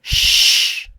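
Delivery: unvoiced shush; shushing hiss. Kittens hushing the noisy bear, shush. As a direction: hush him sharply.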